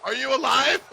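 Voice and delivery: yelled up high; calling out. Acted. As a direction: shout it down the slope. Yelling down to fallen friend.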